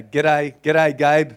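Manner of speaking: loud, broad Australian greeting; Australian accent. Shown as a demonstration. Pastor coaching a volunteer in a loud Australian accent. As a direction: call it out like a cheery Aussie. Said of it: "That's how Australians really talk".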